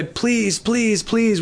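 high, pleading please repeated; mock begging. Pastor mimicking believers begging God, high pleading. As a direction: whine it like a beggar at the door. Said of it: "we don't say".

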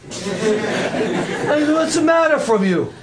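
put-on very slow laughing Brooklyn accent. Donkey asking Balaam in a slow laughing Brooklyn accent. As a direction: drag out the Brooklyn line through a laugh. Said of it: "the donkey was from Brooklyn".

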